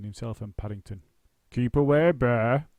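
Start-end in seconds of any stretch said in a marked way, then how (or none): 1.5-2.7 loud, theatrical warning to the bear; dramatic. A narrator's reading. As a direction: ham up the fear like on stage. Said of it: "he said dramatically".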